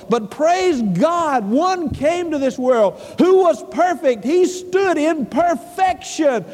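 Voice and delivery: high-pitched, impassioned sermon delivery; fervent preaching. Real, not acted. Preacher praising God that a perfect one came, fervent preaching.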